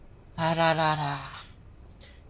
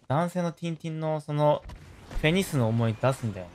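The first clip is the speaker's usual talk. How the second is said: ordinary talk, nothing marked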